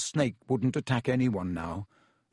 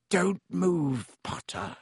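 lazy; 5 semitones higher, slower than usual. A narrator's reading. Snape enjoying Harry facing the snake, lazy. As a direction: start high, let it sink away lazily. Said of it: "said Snape lazily".

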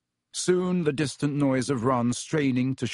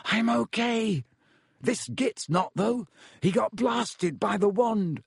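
high calling from behind a barrier; strained, high-pitched distant reply; muffled; 9 semitones higher, slower than usual. A narrator's reading. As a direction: shout back as if through rock, without much volume. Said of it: "came Ron's muffled voice".

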